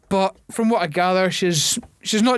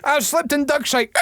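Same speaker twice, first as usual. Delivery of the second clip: annoyed ghost; 7 semitones higher than usual. Pretend. Voicing ghost slipping in dog mess.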